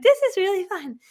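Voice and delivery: high, put-on perky voice; mock your own forced cheer; fake enthusiasm. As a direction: pretend it's fun in a high false voice. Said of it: "became a fake version of myself".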